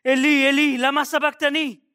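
high, loud anguished cry to heaven; Jesus crying out from the cross. Acted. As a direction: cry it out with full force. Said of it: "s'écria d'une voix forte".

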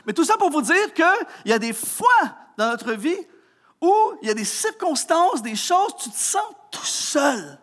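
fervent preacher's refrain; preaching. Acted. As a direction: drive the point home to the congregation. Preaching at rising force.

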